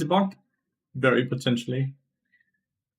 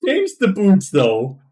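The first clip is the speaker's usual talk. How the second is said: loud; 6 semitones higher, 8 dB louder, slower than usual. Real, not acted. Indignant demand to editor.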